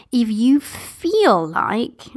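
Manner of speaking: slow, drawn-out "feel like" with a rise; stress on feel like. Shown as a demonstration. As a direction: lean on "feel like", stretching it slowly. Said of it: "I'm using the words feel like here".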